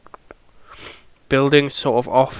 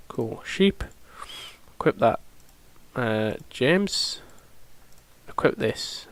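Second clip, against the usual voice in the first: usual speaking voice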